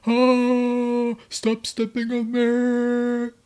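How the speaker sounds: high, loud, drawn-out wail; pained cry. Retold or imitated. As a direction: wail it like she's hurt. Grace in pain, imitated.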